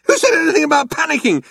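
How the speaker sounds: high, fast indignant retort; snapped. A narrator's reading. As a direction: snap back hotly. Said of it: "snapped Arthur".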